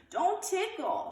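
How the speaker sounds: very high, slow shriek. A narrator's reading. Ramona shrieking as she runs out the door. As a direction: shriek it as you flee. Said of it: "shrieked Ramona".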